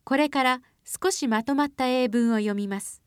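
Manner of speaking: usual speaking voice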